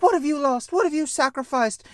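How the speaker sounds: Rey pressing Luke hard; lightly voiced intense Rey; Rey demanding. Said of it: "Rey be like".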